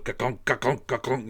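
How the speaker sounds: punchy, rhythmic ka-kunk noises; imitated mechanical clunk. Comedian mimics the oil pump dial, clunking noises. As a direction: imitate a dial clunking round in even beats. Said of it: "it goes".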